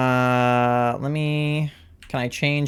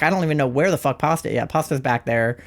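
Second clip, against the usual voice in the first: ordinary delivery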